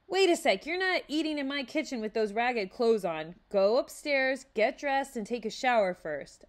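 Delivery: loud, playful bossy mother; cheeky. A narrator's reading. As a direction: tease him while ordering. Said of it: "with a hint of cheekiness".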